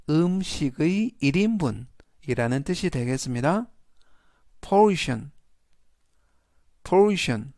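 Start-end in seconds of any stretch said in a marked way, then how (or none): 4.6-7.5 portion, said carefully as POR-tion; teacher modelling portion; model pronunciation. Shown as a demonstration. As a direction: say portion clearly for repetition. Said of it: "portion이라고 발음하시면 되겠습니다".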